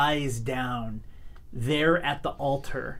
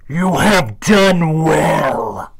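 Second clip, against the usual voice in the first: booming, slow, far-off demon voice; low haunting voice; 14 dB louder, much slower than usual. Acted. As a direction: speak like a demon from the shadows. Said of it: "a deep, dark voice from the back of the room".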